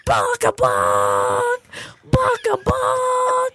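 long, honking car-horn blasts; drawn-out horn noise made by voice; imitated car horn. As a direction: honk it slowly like a beeping horn. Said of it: "So I hear this horn beeping".

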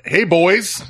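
swagger up and hail the crew; cocky. Retold or imitated. Homeowner greeting the utility crew, cocky. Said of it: "all cocky".

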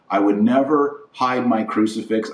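ordinary talk

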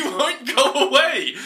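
ordinary delivery

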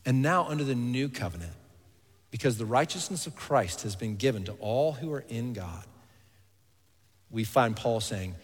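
normal talking voice

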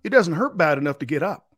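high, lightly voiced farmer, unbothered; lazy farmer. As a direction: shrug it off in a lightly played farmer voice. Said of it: "And he says".

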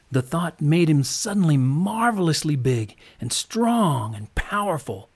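plain reading voice

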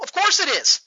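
loud, high-pitched outburst; exasperated yell. Real, not acted. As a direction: yell back as if it's glaringly obvious. Yelling back at stereotype objection.

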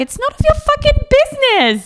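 high-pitched angry re-enactment; indignant retold outburst; pissed off. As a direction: relive the outrage, pitch shooting up. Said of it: "This pissed me off".

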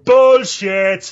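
loud high shout from afar; yelling across the room. Pretend. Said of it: "you'll shout across the room".

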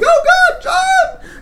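very high, loud shriek of horror; mother screaming her husband's name; mother's scream. Acted. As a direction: shriek his name in terror. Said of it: "you hear your mother scream".

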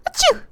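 loud high fake sneeze. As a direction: act out a big sneeze. Poem reader acts out sneeze.

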